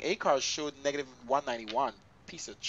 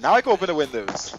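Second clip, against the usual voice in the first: much louder, triumphant; 2 semitones higher, 14 dB louder than usual. Real, not acted. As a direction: announce it loudly. Loud pilot joke.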